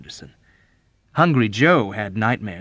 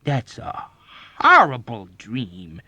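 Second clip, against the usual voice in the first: drawn-out, loud disgust on horrible; slow, appalled outburst; appalled cry; 2 semitones higher, 7 dB louder, slower than usual. A narrator's reading. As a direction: cry out, dragging the word horrible. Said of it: "Major Sanderson cried".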